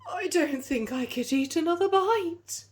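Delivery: too full to eat another bite; groaning iguana. Acted. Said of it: "he groaned".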